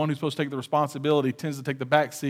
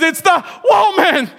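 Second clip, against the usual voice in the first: mocking blame; 9 dB louder, slower than usual. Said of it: "he says".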